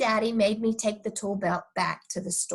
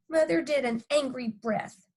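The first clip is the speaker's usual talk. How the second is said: usual speaking voice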